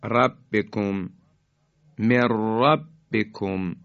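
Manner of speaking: من ربکم said slowly as مِرَّبِّکُم with the نون gone into a doubled ر; merged mir-rabbikum. Shown as a demonstration. Quran teacher slowly reciting the merged من ربکم.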